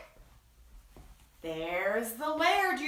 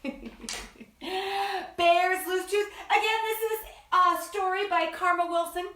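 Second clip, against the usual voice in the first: ordinary talk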